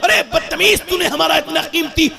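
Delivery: high-pitched, strained scolding outburst; angry parental scolding. Acted. Parent scolding a child over broken glass, angry.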